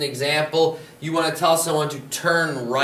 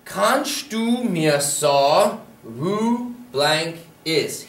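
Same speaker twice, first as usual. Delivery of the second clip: slow model phrase; much slower than usual. Shown as a demonstration. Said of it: "teacher models the phrase slowly".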